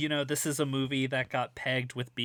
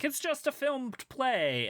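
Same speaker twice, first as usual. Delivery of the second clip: mocking critics; 13 semitones higher, slower than usual. Mocking critics' dismissive take.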